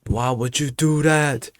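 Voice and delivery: high, drawn-out mock protest. Acted protest at teacher.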